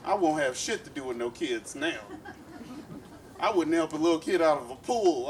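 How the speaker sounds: normal talking voice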